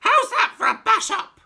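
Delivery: put-on screechy old grandma crowing. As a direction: screech it down from the attic. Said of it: "she shouted".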